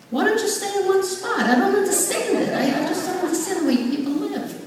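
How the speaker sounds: very high put-on complaining voice; baffled husband. Husband hearing of wife's house-to-house party, mock exasperation.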